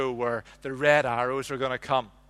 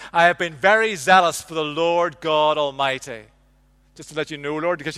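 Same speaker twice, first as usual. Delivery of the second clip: self-righteous prayer; 2 semitones higher, 7 dB louder than usual. Retold or imitated. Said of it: "a prayer that's mixed with self-righteousness".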